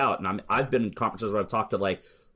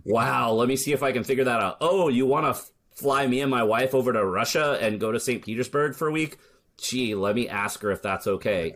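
higher, playfully astonished; mock surprise; 4 semitones higher than usual. Speaker replays invitations to Australia and Russia, mock surprised. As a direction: feign surprise at a welcome offer.

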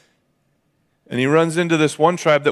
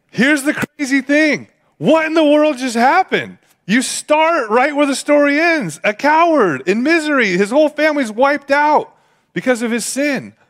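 much higher, louder impassioned preacher; full-force preaching; 11 semitones higher, 3 dB louder than usual. Acted. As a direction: drive the point home from the pulpit. Preacher turns to full sermon.

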